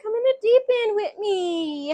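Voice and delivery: high-pitched, put-on girly coaxing; mock coaxing. Girl inviting man into the pool, high mock coaxing. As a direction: imitate a woman luring him in, pitched high.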